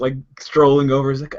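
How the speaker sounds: usual speaking voice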